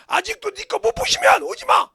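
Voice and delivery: loud, high preaching shout; retold shout. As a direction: hurl the warning at the hall. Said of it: "이 소리요". (크게 높여 외치는 경고)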